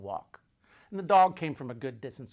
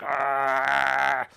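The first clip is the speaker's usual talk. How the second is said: dog growl; 4 dB louder than usual. Retold or imitated. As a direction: growl like a dog that won't give up. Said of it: "He's like".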